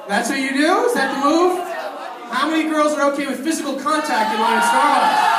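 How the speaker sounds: loud, high incredulous yelling; incredulous shout. Real, not acted. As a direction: yell in mock outrage. Host mocking the move loudly.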